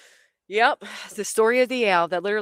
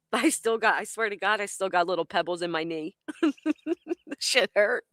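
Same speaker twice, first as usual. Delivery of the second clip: talking through giggles; real laughter. Real, not acted. Storyteller with pebbles still in her knee, laughing. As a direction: laugh helplessly at your own pain.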